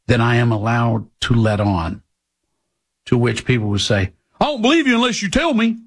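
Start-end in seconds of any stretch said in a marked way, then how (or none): 4.4-5.8 high, fast whiny parody of doubters; mimicking a petulant skeptic; mocking imitation. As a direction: rattle it off high as a mock complaint. Mocking a doubting listener's reply.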